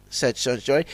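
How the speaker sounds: plain reading voice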